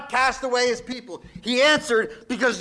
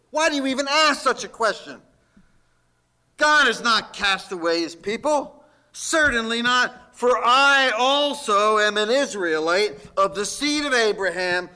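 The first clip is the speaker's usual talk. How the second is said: ordinary talk, nothing marked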